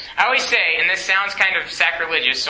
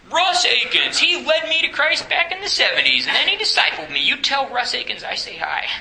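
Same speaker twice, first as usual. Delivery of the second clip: astonished recognition; 9 semitones higher than usual. Acted. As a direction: act out a stranger bursting with delighted surprise. Acts out astonished wedding guest recognizing name.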